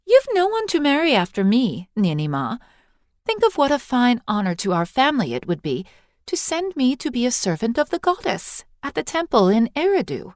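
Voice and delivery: put-on high, bright-eyed girl pleading her case; eager young girl. Eily coaxing Ninny Ma toward the temple, eager girlish voice.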